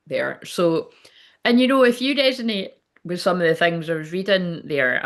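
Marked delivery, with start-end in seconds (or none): none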